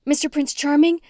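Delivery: high calling of his name; anxious call. A narrator's reading. Heroine calling for her cat in the bedroom, high and anxious. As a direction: call out hoping he answers.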